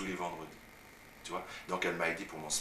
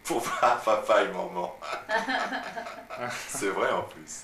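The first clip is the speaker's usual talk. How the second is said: laughing; 4 semitones higher, 7 dB louder, slower than usual. Real, not acted. Laughs at his own answer.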